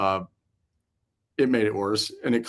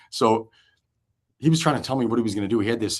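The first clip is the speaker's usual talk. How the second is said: usual speaking voice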